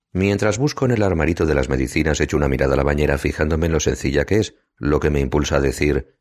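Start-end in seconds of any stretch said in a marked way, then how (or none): none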